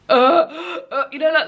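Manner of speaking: ordinary delivery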